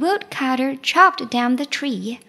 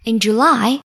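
ordinary delivery, nothing marked

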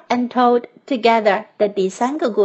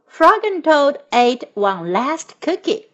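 plain reading voice

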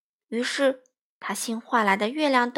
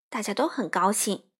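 ordinary delivery, nothing marked

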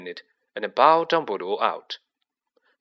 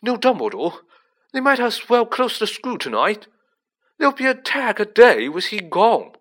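put-on higher, louder alarmed boy; rattled, urgent young voice; hoarse dread; 11 semitones higher, 7 dB louder than usual. A narrator's reading. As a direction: blurt it out in panic. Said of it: "he said hoarsely".

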